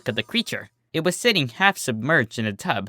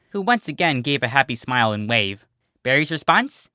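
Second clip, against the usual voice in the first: usual speaking voice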